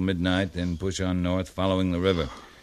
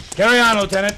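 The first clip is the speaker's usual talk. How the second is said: higher, louder order; shouted order; 10 semitones higher, 9 dB louder, faster than usual. Acted. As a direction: shout back over the horses. Captain shouts as he rides off.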